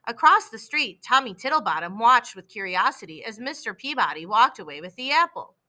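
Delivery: ordinary delivery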